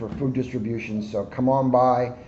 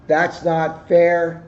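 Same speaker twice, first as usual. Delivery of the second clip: sulky hare; 8 semitones higher, 9 dB louder, much slower than usual. Acted. Hare complaining that he lost.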